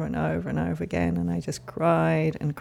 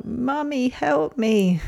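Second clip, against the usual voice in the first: put-on high, small plea of a frightened baby; a reenacted cry for mummy, pitched up high; child's plea; 7 semitones higher, much slower than usual. Therapist reliving her baby self, a high pleading call. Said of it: "the words that straight away came were".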